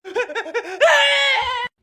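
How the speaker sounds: put-on high, gleeful little-boy cackle; bratty kid laugh. Billy gloating at his grounded dad, high bratty laugh. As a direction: laugh like a kid who got away with it.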